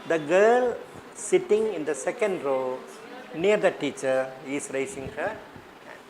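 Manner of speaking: slow, deliberate dictation pace; slow model sentence. Shown as a demonstration. Teacher gives the class the final version, slowly. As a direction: spell out the model answer slowly. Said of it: "the final version".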